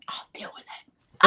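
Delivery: whispered under breath; hushed whisper to herself. Shown as a demonstration. Whispered repeat of line.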